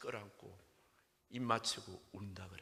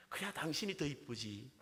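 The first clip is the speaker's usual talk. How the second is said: higher-pitched Seoul groom's reply; Seoul accent groom; 5 semitones higher than usual. Acted. Seoul groom flatters his new bride, raised Seoul-accent voice. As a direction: reassure the bride in Seoul speech. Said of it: "신랑이 뭐라 그랬을까요".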